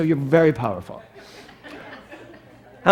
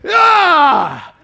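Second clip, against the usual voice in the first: high shouted superhero yell; kid's battle cry; 9 semitones higher, 9 dB louder than usual. Retold or imitated. Preacher mimics a boy's superhero yell. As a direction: yell like a boy in a cape.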